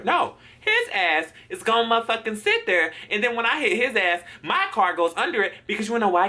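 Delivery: worked-up, exasperated complaint; indignant rant. Retold or imitated. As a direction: rant about the other driver, pitch up. Ranting at the truck driver.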